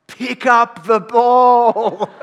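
huge drawn-out sideline yell, high and very loud; imitating a dad bellowing from the sideline; dad screaming. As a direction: scream it across the field at the top of your lungs. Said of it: "screaming at the top of his lungs".

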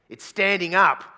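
loud, high complaint; older man loudly objecting to the hair; indignant older guy. Retold or imitated. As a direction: blurt it out, outraged. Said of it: "They go".

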